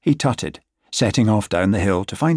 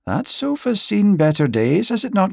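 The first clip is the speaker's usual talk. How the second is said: higher, lightly mocking neighbour's voice; mocking Hibbert; 10 semitones higher than usual. Gregor mocking his nosy neighbour Hibbert, in a higher, mildly mocking voice. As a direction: pipe it up like a meddling busybody neighbour. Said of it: "saying things like".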